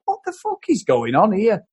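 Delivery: ordinary talk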